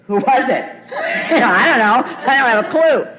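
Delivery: laughing loudly through the words; can't hold back the laughter. Real, not acted. Laughing recalling hospital question.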